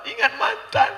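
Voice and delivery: high, laughing mock whimper; mock sobbing husband. Husband answers wife, high mock whimper. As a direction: squeak it out like a sniffling husband.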